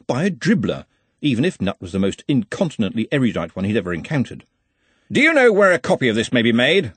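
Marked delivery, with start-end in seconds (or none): 5.1-6.9 loud, raised wizard voice. A narrator's reading. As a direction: say it over the room. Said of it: "said Ridcully loudly".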